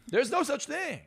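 loud, high-pitched shout of disbelief; emphatic outburst. Real, not acted. Guest rejects bad-day excuses to the host, loud emphatic outburst. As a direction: burst out, throwing the excuse away.

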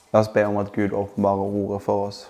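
ordinary talk

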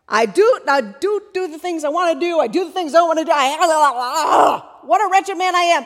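loud, high, tangled outburst breaking into yells; mock-tormented rant, shouted; frantic self-condemnation. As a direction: work yourself into a flustered, hammed-up frenzy. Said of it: "it's this really quick turn. It's like".

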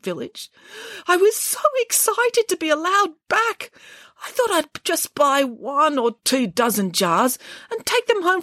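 Boris's high, sorrowful bear voice; tearful bear. Acted. Boris tells Nanny Piggins about the honey emporium. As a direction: tell it as a sad, dreamy bear.

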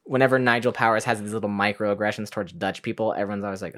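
usual speaking voice

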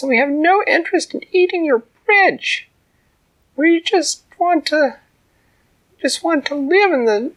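high, loud little ant; tiny ant voice. A narrator's reading. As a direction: speak up boldly as the tiny ant. Ant pleads her case.

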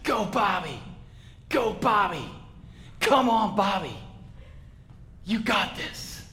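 mom's high-pitched cheer from the sidelines; imitated cheering yell. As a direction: cheer a runner on, pitched far above your own voice. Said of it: "yelling at the top of her lungs".

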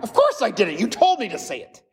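put-on high, indignant child voice; sassy toddler. Toddler answering the parent, high indignant child voice. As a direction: talk back like a cheeky little kid. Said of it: "like the two-year-old".

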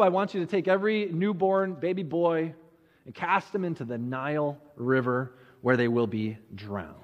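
ordinary talk

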